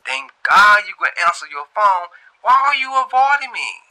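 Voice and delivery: caller's voice. A narrator's reading. Unknown caller relieved she answered, high and loud. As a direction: plead high and loud into the phone. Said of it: "the voice say".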